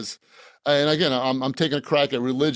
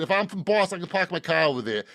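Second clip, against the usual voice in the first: Boston accent. Shown as a demonstration. Host shows he can do the accent, Boston accent. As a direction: demonstrate a thick Boston accent. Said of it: "I can do the Boston accent".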